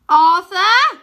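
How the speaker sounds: very loud high call over wings; shout. Acted. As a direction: yell his name over the noise. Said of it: "Wynne has to shout".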